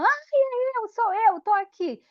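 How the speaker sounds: put-on high, eager child's voice; little girl answering. The twin sister pupil answers the teacher in the game, high childlike voice. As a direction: pipe up like a small girl saying here I am.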